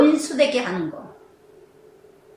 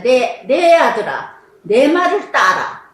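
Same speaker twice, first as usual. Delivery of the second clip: slow, louder drawn-out plea to her son; mother's commanding call; 4 dB louder, slower than usual. Retold or imitated. Preacher voicing Rebekah calling her son Jacob, slow and commanding. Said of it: "리브가의 이 모습 보세요".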